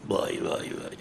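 low, soft "oy" aside; barely audible lament; hushed dismay. Retold or imitated. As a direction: mutter it almost without voice. Preacher reacts to son's petty request.

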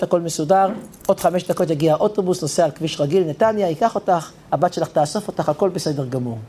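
calm her down that it's all arranged; reassuring. Retold or imitated. Chaim reassures the old woman. (מרגיע בקול נמוך ושקט)

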